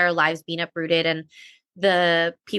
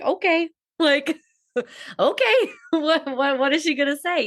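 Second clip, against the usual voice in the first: high-pitched okay, breaking into laughter; amused, high retold quote; laughing retelling; 9 semitones higher than usual. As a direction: imitate mom's reply while laughing. Laughing retelling of mom's reply.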